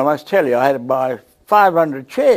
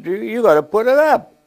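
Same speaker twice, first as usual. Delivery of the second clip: higher, louder insistent friend voice; friend insisting; 8 semitones higher, 5 dB louder than usual. Retold or imitated. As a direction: lightly play the pushy friend. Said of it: "He said".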